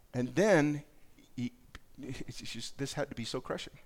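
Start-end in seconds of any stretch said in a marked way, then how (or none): none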